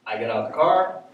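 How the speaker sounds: ordinary talk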